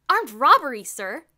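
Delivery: loud cheeky announcement. A narrator's reading. Bruno to Mister Sturgeon, announcing loudly. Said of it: "Bruno announced loudly".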